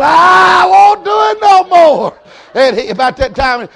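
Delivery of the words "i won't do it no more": very high slow fake wail, loud; boy hollering loud so Mama hears; hollering on purpose. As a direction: holler like a whipped boy, hammed up. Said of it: "I said, Keep hollering".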